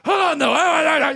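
put-on drunk emcee. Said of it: "that's really drunk".

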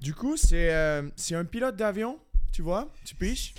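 mock French-from-France accent; accent français de France. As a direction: imitate a Frenchman's accent, hesitantly. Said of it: "fais un accent français pour voir". (faux accent de France)

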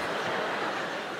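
short unvoiced laugh at the prank; a quiet breathy chuckle. Real, not acted. Reacts to son's prank.

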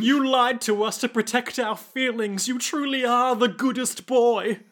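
high-pitched mock weepy praise; tearful gushing. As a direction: gush as if moved to tears. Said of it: "And they're crying".

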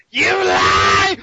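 low growling villain shout; Green Goblin voice. Acted. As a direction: bark the accusation in a deep snarl. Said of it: "your Norman Osborn Green Goblin".

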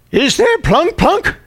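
put-on high, slow, suspicious little old lady; old lady imitation. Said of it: "she goes".

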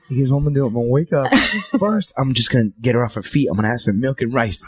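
low-pitched imagined mastermind monologue; scheming toddler. Acted. Imagined daughter planning to trick her parents, scheming. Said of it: "Like, she's like".

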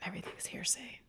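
soft, unvoiced whispered aside; whispering. Real, not acted. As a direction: whisper it under your breath.

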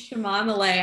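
Chamomile said sha-MOM-ih-lay, stress on MOM; enjoy saying Chamomile as sha-MOM-i-lay; playful chamomile. Shown as a demonstration. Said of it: "That's way more fun to say".

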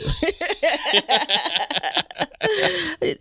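high, rippling fit of laughter; long laugh. Real, not acted. Laughs after hedging her answer.

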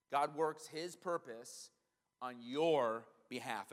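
each word spaced out slowly; slow, deliberate repeat. Shown as a demonstration. Preacher repeating the promise to his congregation, slow and deliberate. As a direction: let it sink in, slowly. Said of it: "repeated slowly for emphasis".

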